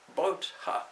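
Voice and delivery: high, loud scornful snort of disbelief; scornful scoff. Acted. Pundit mocking the milkmaid's boat excuse, scornful and loud. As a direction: scoff at the excuse like a haughty priest.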